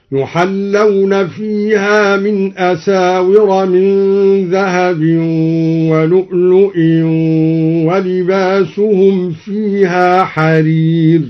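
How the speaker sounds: slow chant, ولؤلؤ linked on as "lu'lu'in"; genitive reading of ولؤلؤ. Shown as a demonstration. Teacher repeats the verse in full, ولؤلؤ chanted with genitive ending. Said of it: "بجر لؤلؤا".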